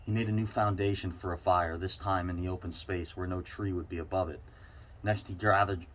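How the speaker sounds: ordinary delivery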